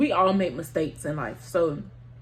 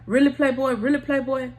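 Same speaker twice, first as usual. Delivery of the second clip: raised, higher voice calling him out; mock confrontation; 5 semitones higher, 5 dB louder than usual. Ex-girlfriend at the car door, confronting him, raised mock anger. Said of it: "and she like".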